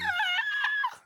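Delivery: a sudden very high shrieking laugh; screaming laugh. Real, not acted. Cracking up at the plug, shrieking laugh. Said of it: "I just screamed".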